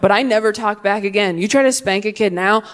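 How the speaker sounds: ordinary delivery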